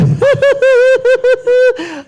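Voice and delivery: high loud villain cackle; shrill maniacal laugh. Retold or imitated. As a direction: cackle like a mad clown. Villain laugh after greeting.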